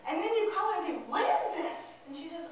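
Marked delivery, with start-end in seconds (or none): 1.1-2.0 alarmed parent. Acted. Parent confronting daughter over quiz.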